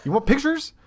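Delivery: very high-pitched, incredulous offer; retold exasperation. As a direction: pitch shoots up in mock helpfulness.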